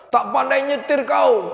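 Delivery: slow, high retold snarl of an angry young driver; mock the furious rider who kicked the car. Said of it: "kata dia".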